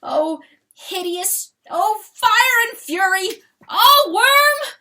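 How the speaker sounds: building from loud to a huge, very high roar; mighty bellow. A narrator's reading. Chanticleer bellowing at the corpse, rising to a roar. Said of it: "bellowed mightily".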